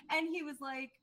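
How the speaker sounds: usual speaking voice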